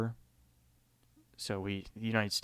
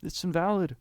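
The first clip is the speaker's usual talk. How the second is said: higher, louder mock outburst; imitated shout; 8 semitones higher, 5 dB louder than usual. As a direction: act out a raised-voice objection. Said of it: "he's breathing heavily, and he shouts".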